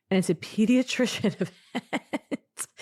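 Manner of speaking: high, slowed words through a laugh; giggling at herself. Real, not acted. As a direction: laugh through the word event. Laughs at herself mid-quote.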